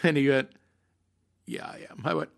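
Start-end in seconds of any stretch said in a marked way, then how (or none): 1.5-2.0 very quiet, near-whispered answer; subdued mock reply. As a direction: imitate a beaten heckler, barely audible. Heckler's subdued reply, retold.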